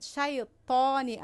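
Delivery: Arabic ṭā in "شیطانی", tongue pushed up; heavy emphatic T. Shown as a demonstration. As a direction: draw out "شیطانی", darkening the ṭ.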